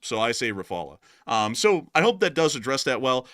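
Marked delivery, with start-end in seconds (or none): 0.5-1.0 Rafale as ruh-FAH-luh; near-French pronunciation. Shown as a demonstration. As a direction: give Rafale a soft final uh. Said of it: "as close as possible to the original".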